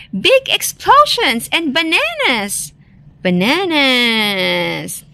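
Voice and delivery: high opening, then a long stretched final word; excited cry. A narrator's reading. Rabbit piles on story ideas for Wolf, excited cry. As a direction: cry out eagerly, drawing out the end. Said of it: "cried Rabbit".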